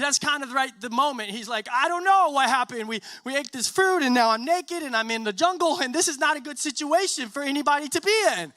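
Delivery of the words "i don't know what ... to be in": high, loud, flustered rush of excuses; acted alarm, pitched up and pushing; mock panic. Adam confessing to Yahweh from the bush, mock panic.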